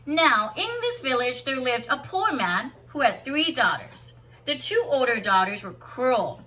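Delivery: lilting read-aloud storyteller voice; story narration. Acted. Teacher reading a picture book to students, lilting storybook voice.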